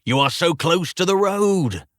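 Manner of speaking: high, excited crowd shout; rousing call from a spectator; cheering yell. A narrator's reading. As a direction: cheer him on from afar. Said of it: "began to cheer and yell things like".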